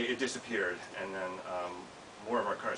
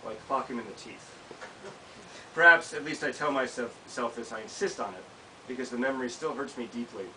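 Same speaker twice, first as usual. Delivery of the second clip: measured reading of a written memoir, a touch livelier than chat; essay reading; 3 semitones higher, 4 dB louder than usual. Acted. Author reading her memoir to an audience, measured reading voice. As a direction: read your essay to the room, steady and deliberate.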